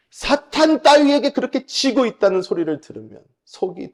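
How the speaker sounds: high, loud tirade imitating a father; retold angry scolding. Pastor father rebukes son for losing to Satan, loud acted scolding. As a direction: thunder indignantly like a scolding father. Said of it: "소리를 버럭 지르시는 거예요".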